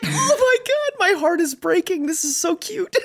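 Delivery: very high-pitched mock-tearful squeal; overcome by cuteness. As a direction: squeak it out, heart melting. Acts being overcome by cuteness.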